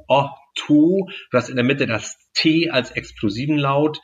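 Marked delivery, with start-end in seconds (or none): 0.1-1.1 „Otto“ with a short first O and a long final O. Shown as a demonstration. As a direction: stretch the last O of „Otto“. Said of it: "ein kurzes und ein langes O".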